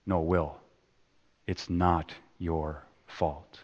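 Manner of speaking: soft, slow and tender; quietly telling him it isn't his fault; gentle reassurance. Acted. Counsellor softly comforting a troubled young man, slow and tender. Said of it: "But Sean keeps going".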